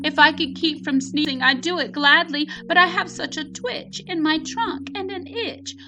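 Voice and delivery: high elephant voice, a touch sad; sorry elephant. Acted. Elephant apologizing to friends, high and mildly sad. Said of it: "said the elephant sadly".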